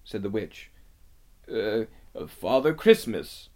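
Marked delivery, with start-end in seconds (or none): 1.5-3.5 hesitant fox, haltingly; nervous stammer. A narrator's reading. Fox confesses to the witch, nervous stammer. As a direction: falter before admitting it. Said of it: "stammered the fox".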